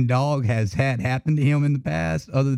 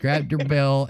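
ordinary talk

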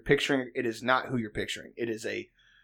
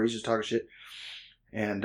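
ordinary delivery

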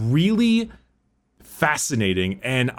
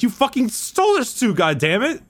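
much higher, exasperated outburst; outraged yell; 10 semitones higher than usual. Retold or imitated. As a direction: blow up in mock outrage. Mocking Emperor's outraged reaction.

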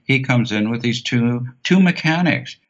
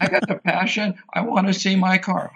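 pitched up high, pressing the point; insistent; 9 semitones higher than usual. Retold or imitated. Customer insisting to the mechanics, pitched up high. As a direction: repeat "I wanna see my car" higher and more insistent.

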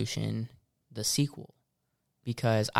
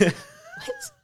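incredulous what; 4 dB quieter than usual. Real, not acted. Incredulous reaction.

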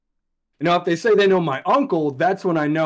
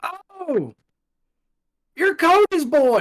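put-on high older local voice; 14 semitones higher, 4 dB louder, much slower than usual. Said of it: "they're like".

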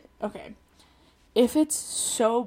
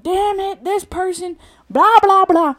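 high-pitched imitation of road rage; mock venting; 10 semitones higher, 8 dB louder than usual. Driver retelling their own venting, mock road-rage gripe. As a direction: rattle off a hammed-up gripe, rising louder. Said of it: "and I'm like".

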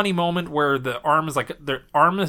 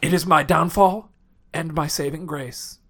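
dramatic dying speech. Pretend. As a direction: fade out like a dying hero. Dying general's last words.

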